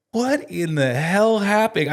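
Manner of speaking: high, loud, drawn-out shocked outburst; retold disbelief. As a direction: act out stunned disbelief, stretching the words. Retold instant shocked reaction.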